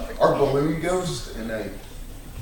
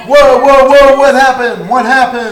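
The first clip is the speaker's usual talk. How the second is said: loud, high cries of alarm; startled, raised-voice questioning; alarmed shout; 10 semitones higher, 13 dB louder than usual. Acted. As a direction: call out urgently to stop people running past. Acts alarmed character stopping fleeing guests.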